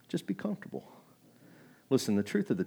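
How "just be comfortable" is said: coaxing hush. Acted. Devil's tempting whisper repeated.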